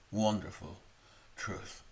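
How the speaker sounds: ordinary talk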